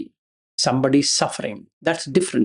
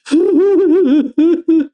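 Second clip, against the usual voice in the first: mock sob-laugh; 12 semitones higher, 5 dB louder than usual. Acted devotee after "I cry there.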